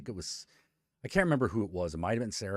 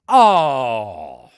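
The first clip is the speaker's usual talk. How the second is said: loud surprised oh; 15 dB louder than usual. Real, not acted. Reacting to irony.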